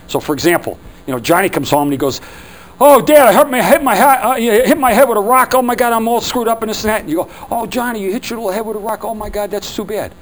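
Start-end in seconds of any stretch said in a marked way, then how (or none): 2.8-7.0 much higher, louder whining complaint; whiny hurt kid. Retold or imitated. Son Johnny comes home to dad, hammed-up whining. 7.5-10.1 put-on higher, gentle fussing over a child; mock sympathy. Shown as a demonstration. Dad repeats back to Johnny, lightly mock-sympathetic. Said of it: "We repeat it back".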